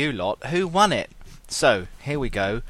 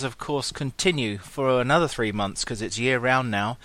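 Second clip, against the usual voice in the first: normal talking voice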